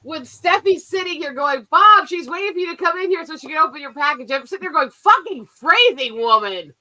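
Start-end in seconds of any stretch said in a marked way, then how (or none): none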